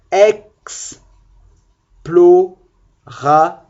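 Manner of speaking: "explorateur" drawn out very slowly, syllable by syllable; stretched out. Shown as a demonstration. Teacher correcting her count, drawing explorateur out slowly. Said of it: "j'ai mal compté les syllabes écrites".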